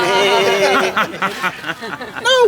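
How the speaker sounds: hammed-up group laugh at the little one; animals mocking laugh. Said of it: "Okay, everyone laugh, laugh".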